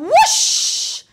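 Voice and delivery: loud upward swoop, long hissing end; whooshing take-off. A narrator's reading. Storyteller sends the broom flying, loud rising whoosh. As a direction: launch the word like a rocket.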